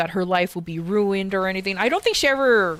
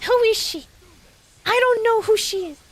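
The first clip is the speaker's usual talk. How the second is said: mock girlfriend voice; 13 semitones higher than usual.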